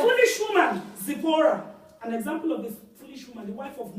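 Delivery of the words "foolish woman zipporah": loud, much higher scornful exclamation; loud scornful preaching. Real, not acted. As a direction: cry it out in contempt. Preacher denouncing Zipporah.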